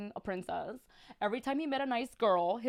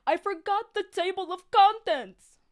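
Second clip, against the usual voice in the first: high, loud odd character voice; put-on narrator voice; 9 semitones higher, 8 dB louder than usual. Narrator Jack announces his mistake in a high put-on voice. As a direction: give Jack a strange high voice. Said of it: "I gotta give him, like, a voice".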